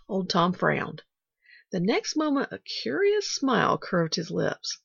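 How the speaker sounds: ordinary delivery, nothing marked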